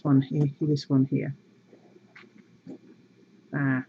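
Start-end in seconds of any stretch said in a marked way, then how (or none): none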